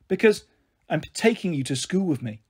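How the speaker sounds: ordinary delivery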